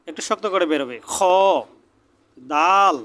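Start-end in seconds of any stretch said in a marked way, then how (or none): none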